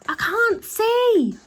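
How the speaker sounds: very high, loud, slow shout. A narrator's reading. Mister Glutton, face full of ice cream, shouts blindly, very high and loud. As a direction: bellow it, blinded. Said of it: "shouted Mister Glutton".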